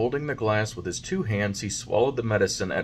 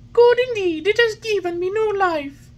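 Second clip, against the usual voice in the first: put-on high, loud happy boy voice; cheerful puppet; 9 dB louder than usual. A narrator's reading. Puppet cheering to the fairy, full of life. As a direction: bounce with new life.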